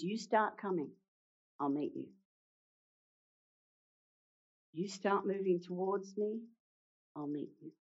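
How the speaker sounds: softening, fading "I'll meet you"; tender encouragement. Shown as a demonstration. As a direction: reassure a frightened friend gently. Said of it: "All Peter has to do is begin to come".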